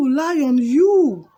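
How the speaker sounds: put-on boy firmly refusing a pleading lion; emphatic refusal. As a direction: push it up in indignant refusal. Boy refuses the caged lion's plea.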